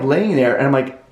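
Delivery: ordinary delivery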